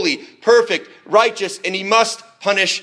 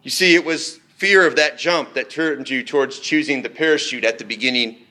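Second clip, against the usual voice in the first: usual speaking voice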